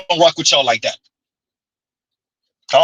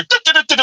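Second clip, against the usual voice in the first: higher singsong du-du-du; mock fingers-in-ears chant; 8 semitones higher, faster than usual. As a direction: hum over them like a child not listening. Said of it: "put their fingers in the air".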